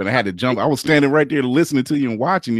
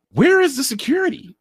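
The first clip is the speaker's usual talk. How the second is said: much higher, incredulous squeal; outraged high cry. Retold or imitated. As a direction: shriek the question in disbelief. Repeats his own outraged question.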